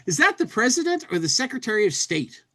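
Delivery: high and fast cartoon voice; comic bubble voice. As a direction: rattle it off high like a gawking tourist. Reading a comic bubble aloud.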